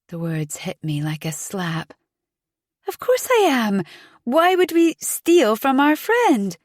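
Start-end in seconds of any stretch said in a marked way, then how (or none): 2.9-6.6 higher, louder defensive outburst; indignant protest. A narrator's reading. Suspect protesting her innocence to the detective, indignant and raised. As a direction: protest it sharply, stung by the accusation.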